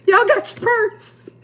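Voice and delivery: high, slow sassy diva; hammed-up high diva line. Mocking diva voice.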